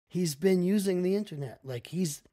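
ordinary delivery

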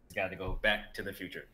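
ordinary talk

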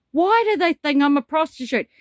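indignant disbelief; shocked question. Retold or imitated.

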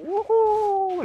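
high, loud joyful whoop; excited whoop. Real, not acted. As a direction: whoop like on a slide. Whoop going down slide.

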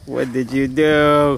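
dramatic slow outcry. Acted. Mock disaster after the take.

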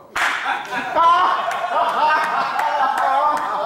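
big, high, loud burst of laughter. Real, not acted. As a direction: laugh hard at the punchline. Laughing at his own punchline.

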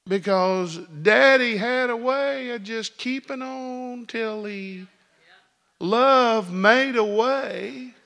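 high, loud, ringing sermon voice; full-force preaching. Acted. Preacher to his congregation, fervent and loud. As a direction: preach it out to the rafters.

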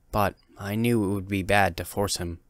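usual speaking voice

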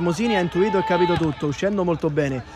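ordinary talk

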